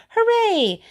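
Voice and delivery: high, loud cheer. A narrator's reading. Townspeople running after the kid, cheering. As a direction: shout it with the running crowd. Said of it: "cheering and sweating and screaming".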